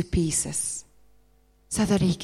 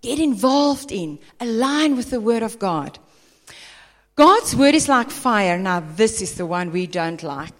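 usual speaking voice

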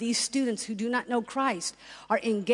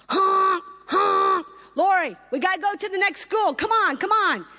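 higher, louder impatient calls from the bus; mock honking and calling; 8 semitones higher, 6 dB louder than usual. Drama team hurries Lori onto the bus, loud and high. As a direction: honk and yell to hurry her up.

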